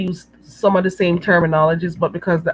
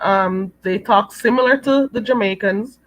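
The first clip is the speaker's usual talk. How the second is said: usual speaking voice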